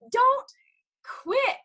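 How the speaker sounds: "don't" and "quit" pitched high and stretched apart; preachy stress on quit. Real, not acted. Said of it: "I feel like I'm preaching".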